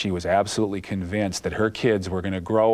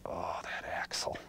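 exasperated whisper; 3 semitones lower, 13 dB quieter than usual. Retold or imitated. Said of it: "And she'd say".

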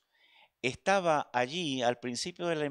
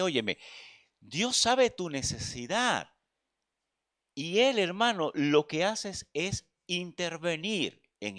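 preaching; 6 semitones higher than usual. Acted. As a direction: preach it with full conviction. Exhortation to the congregation.

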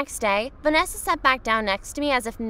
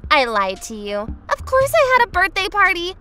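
put-on gloating girl voice; 7 semitones higher than usual. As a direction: rub it in proudly.

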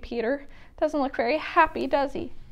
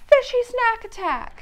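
high, loud excited shout; gleeful battle cry; 12 semitones higher, 5 dB louder than usual. Acted. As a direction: launch the food fight. Polly starts food fight.

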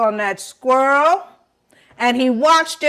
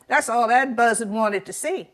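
ordinary delivery

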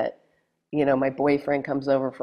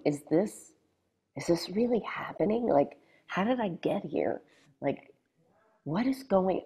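breathy near-whisper of awe; quiet stunned wonder; hushed disbelief; 4 semitones higher, 8 dB quieter than usual. Retold or imitated. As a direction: murmur it like you can't believe it. Nervous thoughts on the plane.

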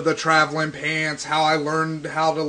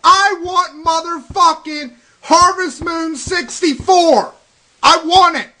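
raised, high-pitched furious rant; angry outburst; 9 semitones higher than usual. Real, not acted. Gamer demanding a game from Nintendo, real angry outburst. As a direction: rant at them, pitch up and punching the swearwords. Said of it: "Sorry, everybody, for that outburst. I'm just mad".

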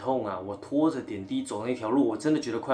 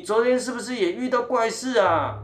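put-on a much higher, chatty older woman's voice; cleaning lady voice; 10 semitones higher, 6 dB louder than usual. Cleaning lady tells the patient about the bed, high chatty voice. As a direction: gossip like the ward's cleaning auntie. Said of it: "清洁大姐就跟我说".

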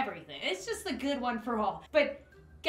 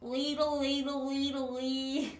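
snail; 3 semitones higher than usual. Acted. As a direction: babble like a silly snail. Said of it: "I'm like a snail".

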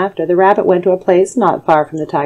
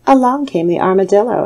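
plain reading voice

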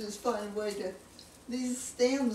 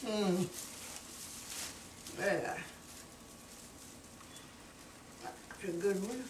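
talking while chewing; words round a bite of food; mouth full; 6 dB quieter than usual. Real, not acted. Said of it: "Hard to talk with your mouth full".

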